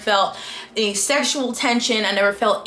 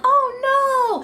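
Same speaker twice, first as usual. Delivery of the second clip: high-pitched playful dismay; mock dismay. Reader seeing miscommunication coming, mock dismay. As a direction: wail it lightly, pitched way up.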